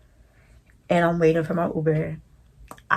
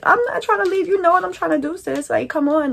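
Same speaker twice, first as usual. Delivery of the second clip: high, loud eager protest; hyped up; 12 semitones higher, 4 dB louder than usual. Retold or imitated. Telling Laura she wants to fight, high and hyped. Friend arrives to back her.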